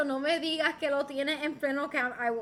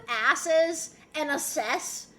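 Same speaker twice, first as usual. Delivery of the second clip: "asses" stressed first, "assess" stressed last; contrasting stress, ASSES and ASSESS; much slower than usual. Shown as a demonstration. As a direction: contrast ASS-es with ass-ESS, slowly. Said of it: "if they're pronounced differently".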